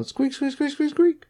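very high mock squeaks; squeaky tricycle noise. Mimicking the creepy guy's tricycle, high squeaks. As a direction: squeak like a tiny tricycle wheel.

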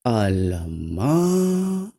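very slow, stretched Alamak; Malay exclamation of trouble, drawn out; drawn-out dismay. Shown as a demonstration. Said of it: "Or in Bahasa Melayu".

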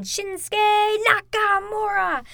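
high, drawn-out announcer's call; hammed-up ring announcer. Fan podcaster naming the champion, as a mock ring announcer. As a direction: stretch the name out like a ring announcer.

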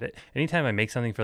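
ordinary talk, nothing marked

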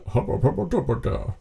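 lightly voiced male assistant babble; mock male assistant voice. Imitating Google's gendered voice for co-hosts, mock male babble. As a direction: mumble nonsense as a male voice assistant. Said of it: "like, an amab voice".

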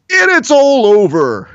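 high, loud announcer sign-off; Mike Goldberg call. Retold or imitated. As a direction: belt it like a fight announcer. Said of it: "he gets paid to essentially say the, the phrase".

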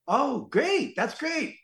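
high, swooping thrilled reply; delighted gushing. Retold or imitated. Actor thrilled the director remembers him, high gushing. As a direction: gush with relief, pitch soaring.

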